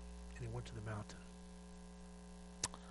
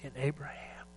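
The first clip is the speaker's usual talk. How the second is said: whisper; 5 dB quieter than usual. Real, not acted. Whispered pause.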